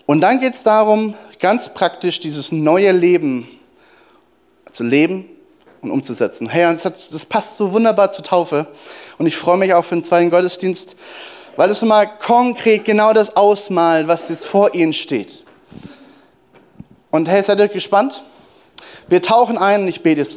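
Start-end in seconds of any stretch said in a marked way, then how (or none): none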